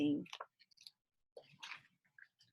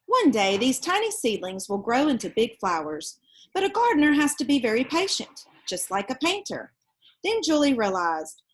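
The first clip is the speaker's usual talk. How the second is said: put-on lively read-aloud voice for children; storybook reading; 2 semitones higher, 5 dB louder than usual. Reading a picture book aloud to children, lively storytelling. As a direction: tell it like a bedtime picture book.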